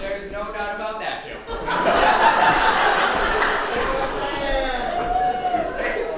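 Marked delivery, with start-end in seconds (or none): 1.4-5.7 long, loud, higher laugh. Real, not acted. Announcer laughs long and loud after Jill's insult. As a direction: crack up hard at being called the worst.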